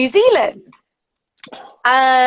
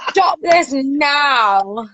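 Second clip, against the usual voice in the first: slow mock scolding; 2 semitones higher, much slower than usual.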